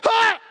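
high loud strained gym roar; weightlifter grunt-yell. Retold or imitated. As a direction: bellow like a lifter on the bench. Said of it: "and he goes".

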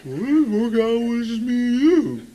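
goofy, slow cartoon-dog drawl; Scooby-Doo. Father imitating teenage son's changing voice, goofy slow dog impression. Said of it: "He sounds like Scooby-Doo".

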